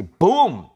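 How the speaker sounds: loud, high "pum"; sudden boom sound effect; imitated impact. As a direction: punch out the collision noise.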